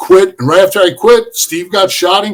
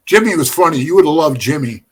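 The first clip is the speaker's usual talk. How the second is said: ordinary talk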